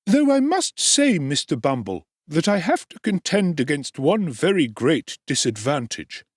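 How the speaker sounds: high, aggrieved complaining tradesman; indignant undertaker. A narrator's reading. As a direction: sound ill-used and hard done by. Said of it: "with the becoming indignation of an ill-used man".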